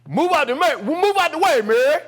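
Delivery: loud, high command; forceful, repeated mock bellow; dad's shouted order. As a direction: imitate an angry father ordering her aside. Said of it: "I hear".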